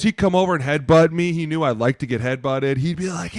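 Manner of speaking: ordinary talk, nothing marked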